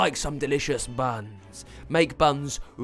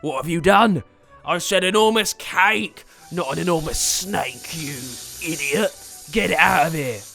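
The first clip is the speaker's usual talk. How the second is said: raised, loud outraged scolding; angry wizard; 6 semitones higher, 7 dB louder than usual. Acted. Wizard scolding sprite over the snake, loud outrage. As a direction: shout the correction at a hopeless helper. Wizard horrified by the wrong creature.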